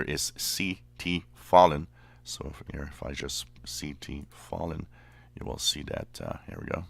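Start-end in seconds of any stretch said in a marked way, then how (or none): none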